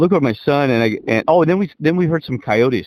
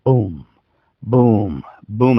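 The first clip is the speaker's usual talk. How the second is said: low, spaced-out booms; imitated banging; 3 semitones lower, much slower than usual. Teller imitating banging in a mine shaft, low spaced booms. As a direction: mimic a distant bang, pausing between each. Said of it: "we hear this damn banging".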